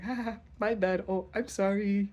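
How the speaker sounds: high, airy imitation of a careless sorry; hammed-up breezy apology; imitating the girl who ghosted him; mock girl apology.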